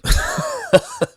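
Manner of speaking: sudden squeaky giggle; high-pitched laugh. Real, not acted. Laughing at awkward phrase.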